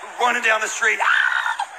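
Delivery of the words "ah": breathy panicked yell while fleeing; mock yell. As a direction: cry out as you run away. Boys fleeing down the street.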